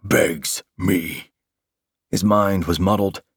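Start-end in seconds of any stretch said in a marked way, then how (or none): 0.0-1.3 slow, mocking monster growl; monster taunt. A narrator's reading. Monster mocking his begging opponent, slow taunt. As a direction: taunt him slowly in a monstrous voice. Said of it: "it taunted".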